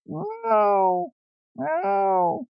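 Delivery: high rising meow; cat crying meow. A narrator's reading. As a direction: plead like a cat. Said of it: "cried Simpkin".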